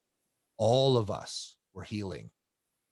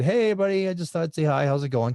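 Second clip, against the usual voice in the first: bright, louder, higher hello to the room; cheery greeting; 8 semitones higher, 5 dB louder, faster than usual. Retold or imitated. Popping into the boot camp studio to greet members, loud and cheery. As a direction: call out a happy hello to everyone.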